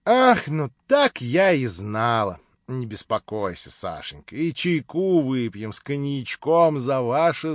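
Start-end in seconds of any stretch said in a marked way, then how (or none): none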